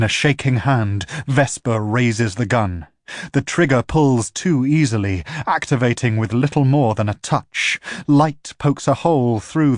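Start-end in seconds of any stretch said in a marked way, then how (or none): none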